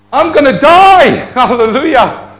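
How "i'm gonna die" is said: high, loud cry of doom; dramatic cry. Acted. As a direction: belt out the doom. Dramatic jump illustration.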